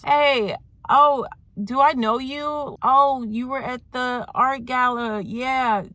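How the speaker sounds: high-pitched, drawn-out mock greeting; acted surprised recognition. Woman spotting the man at the store, acted surprised recognition. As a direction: play someone suddenly placing a face, pitch lifted.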